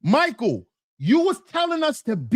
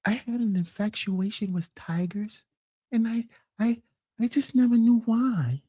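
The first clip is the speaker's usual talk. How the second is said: timid Michael voice. Retold or imitated. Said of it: "Michael's real voice".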